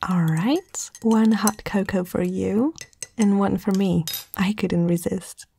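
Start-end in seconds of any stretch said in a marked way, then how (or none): none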